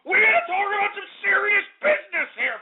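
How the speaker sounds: high, strained rant. Acted. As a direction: shout it high at the callers. Host ranting at callers.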